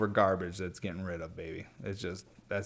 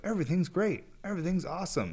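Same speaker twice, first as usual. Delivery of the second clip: much higher, forced bright cheer; fake cheerful; 9 semitones higher than usual. Mocking people who pretend everything is fine, fake cheerful. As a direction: sell a fake happy front while panicking inside. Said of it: "some fake shit".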